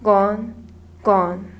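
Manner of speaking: spaced repeats sinking in pitch; wistful fading. A narrator's reading. Storyteller ends on the lost magic, wistful fading. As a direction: let each repeat drop away, wistfully.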